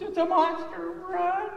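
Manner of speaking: very high, loud screaming; panicked scream. Acted. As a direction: scream in terror and flee. Dinosaurs fleeing in panic.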